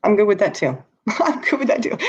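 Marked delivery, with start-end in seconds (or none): 0.9-2.0 amused, laughing through the words, pitched up. Real, not acted. Joking to viewers about being called names, laughing. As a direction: laugh as you say it. Laughing at her own nickname joke.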